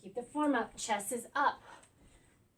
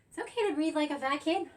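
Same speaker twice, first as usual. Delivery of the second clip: ordinary talk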